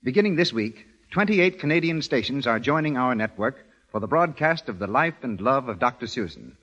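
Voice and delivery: ordinary talk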